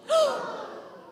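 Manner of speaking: high gasp with a laugh; big surprised gasp. Shown as a demonstration. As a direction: gasp like a lion face. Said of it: "Another lion face".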